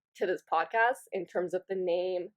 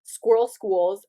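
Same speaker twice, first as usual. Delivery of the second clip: slow and louder, testing the words; slow, deliberate tongue-twister try; sounding out the phrase aloud; saying it to myself; 6 dB louder, slower than usual. Retold or imitated. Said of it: "I'm saying to myself".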